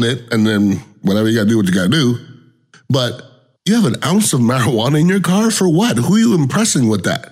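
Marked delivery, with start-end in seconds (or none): 3.6-7.3 higher, incredulous questioning. Real, not acted. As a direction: ask it in disbelief. Incredulous rant.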